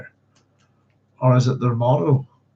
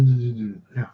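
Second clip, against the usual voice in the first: usual speaking voice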